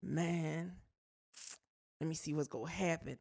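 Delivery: low, very soft muttering to oneself; hushed, resigned wait-and-see, acted out; wary inner thought. Coworker bracing for his bad day, hushed wary muttering.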